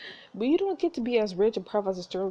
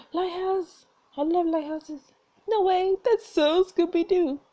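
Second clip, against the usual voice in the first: high, bright-eyed delight, getting louder; excited; 10 semitones higher, 4 dB louder, slower than usual. Real, not acted. Players thrilled by the lighthouse keeper, high and excited.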